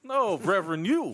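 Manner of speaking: threatening. Pretend. Mock threatening reply.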